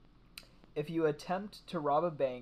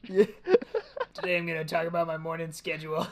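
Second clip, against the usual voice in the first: put-on old man voice; 3 semitones higher, 6 dB louder than usual. Playing old podcaster.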